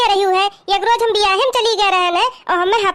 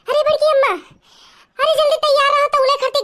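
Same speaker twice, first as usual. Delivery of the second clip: calling out; 5 semitones higher than usual. Acted. Calling out to elder woman.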